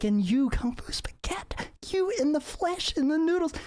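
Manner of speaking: very high starstruck squeal. Acted. Kamala meeting Kung Fu Spaghetti, squealing very high. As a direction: squeak it out, overwhelmed with delight. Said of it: "squealing at the highest of pitches".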